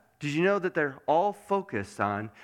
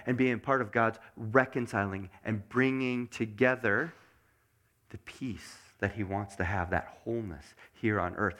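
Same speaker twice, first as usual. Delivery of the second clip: ordinary talk, nothing marked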